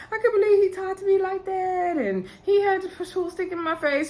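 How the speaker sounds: high-pitched whiny crying imitation; mock crying. Upset woman crying to her consoler, mock tearful high voice. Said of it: "Sharice is crying".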